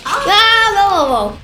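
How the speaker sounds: high, loud startled cry. Real, not acted. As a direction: jolt back in alarm. Sudden game event.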